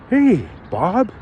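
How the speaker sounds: eager, high and bright on the phone; like calling your childhood best friend; excited phone greeting. Shown as a demonstration. Salesman greeting a customer by phone, eagerly excited. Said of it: "You wanna talk as if".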